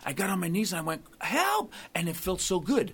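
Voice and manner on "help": retold cry for help. Penitent on his knees at home, mock crying out. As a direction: re-enact crying out, pitched way up. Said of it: "you cry out to the Holy Spirit".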